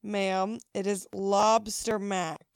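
loud, deliberate stress on "lobster mac"; pointed explanation. Retold or imitated. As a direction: lean hard on "lobster", as if stating the obvious. Hostess spelling out the obvious.

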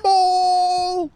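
high shrieking cry of mole; Austin Powers mole yell. Retold or imitated. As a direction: shriek the word like the movie gag. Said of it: "It's like the scene in".

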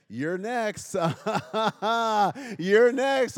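chuckling sing-song "you're next"; teasing laugh. Retold or imitated. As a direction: tease with a laugh, lightly. Old people teasing at weddings.

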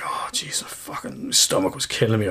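whispered groan of a sore stomach; pained whisper. Retold or imitated. To his girlfriend in bed, a pained whisper. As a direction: breathe it out, hurting. Retelling night stomach pain.

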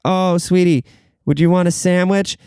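high, sugary mom voice; sweet mom. Retold or imitated. Mom offering her bored kid a sandwich, high and sweet. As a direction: offer it like a doting mother. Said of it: "She'd be like".

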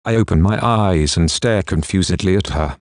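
ordinary delivery

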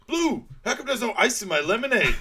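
high, loud demanding yell; mock bossy shout. Frank yelling at old Blue over his lemonade, mock demanding shout. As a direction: bark it like a spoiled boss.

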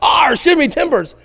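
a lighter retelling of the pirate chant. Retold or imitated. Pastor repeats his son's pirate line to the congregation, lightly voiced. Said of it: "like this pirate chant of some kind".

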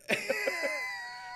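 high burst of laughter; real laughter. Real, not acted. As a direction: break up laughing. Laughs at his own story.

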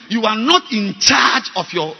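loud, high, fired-up preaching; shouting from the pulpit, almost laughing; preaching at full force. Real, not acted. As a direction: hammer it home to the congregation.

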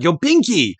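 high, cheery Luffy getting the name wrong; lightly voiced Luffy, clueless and happy; mock Luffy. Friend voicing Luffy misnaming the clown, high and cheery. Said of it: "It's like".

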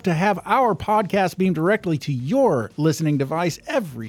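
upbeat show outro read; announcer voice. Acted. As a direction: sign off like a radio host.

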